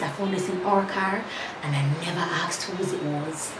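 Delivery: ordinary talk, nothing marked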